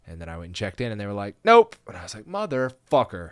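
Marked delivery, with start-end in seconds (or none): 1.4-1.8 loud, high one-word rejection; acted loud dismissal; abrupt refusal. 2.2-3.3 slow, drawn-out swearing; louder, higher start, dragged-out curse; exasperated curse. Retold or imitated. Retold reaction to being refused.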